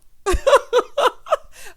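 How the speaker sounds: high, loud shrieking laugh; screaming laugh. Real, not acted. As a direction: lose it at the vague answer. Cracking up at "about a while ago.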